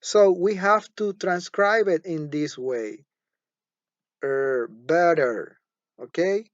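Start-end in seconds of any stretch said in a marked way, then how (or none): 4.2-5.6 "better" slowly, ending in an r-coloured er; slow teacher's model of "better"; r-coloured schwa. Shown as a demonstration. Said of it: "it is followed by an er".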